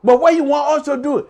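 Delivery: high and loud, patronising; indulgent crowd. Retold or imitated. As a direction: coo at him like a child, then press. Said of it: "They say".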